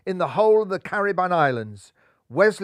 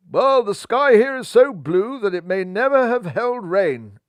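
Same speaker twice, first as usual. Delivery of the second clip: mumbling with full mouth; 5 semitones higher, 8 dB louder than usual. A narrator's reading. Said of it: "mumbled Bunny Johnson".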